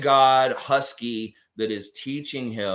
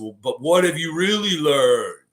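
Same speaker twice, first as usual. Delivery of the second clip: louder, higher, stretched-out question; theatrical master's challenge; insistent guru; 5 semitones higher, 7 dB louder than usual. Acted. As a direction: press the pupil with a swelling voice. Guru presses the Raptor.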